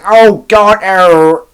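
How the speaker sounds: high, loud camp Kenneth Williams wail; hammed-up exclamation as Kenneth Williams; Kenneth Williams impression. Imitating the impersonator doing Kenneth Williams at the barbecue, loud camp wail. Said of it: "He was doing".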